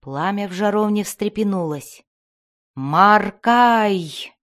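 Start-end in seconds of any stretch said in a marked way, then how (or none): 2.7-4.3 louder, drawn-out call to a spirit; raised-voice incantation of a name; summoning cry. A narrator's reading. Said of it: "вскричал Дендибар".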